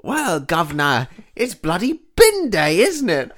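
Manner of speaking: high, loud, slow cockney reply; a cheery cockney bin man answering up; cockney workman. Pretend. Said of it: "he goes".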